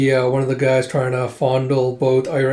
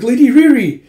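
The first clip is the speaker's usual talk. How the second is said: loud, high-pitched acted cry; calling out; 10 semitones higher, 9 dB louder than usual. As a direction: call after her urgently. Calling out after Riri.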